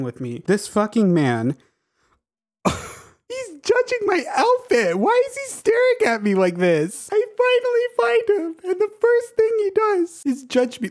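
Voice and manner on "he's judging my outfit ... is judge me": very high, excited wail; indignant squeal. Real, not acted. Gamer feels judged by the new villager, squealing indignantly. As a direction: protest it high through giddy laughter. Villager stares at their outfit.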